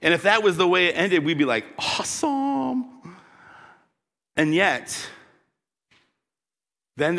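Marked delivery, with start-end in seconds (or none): 1.8-3.2 drawn-out high awesome; mock awesome. Preacher imagining success, drawn-out awesome. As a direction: savor it, high and slow.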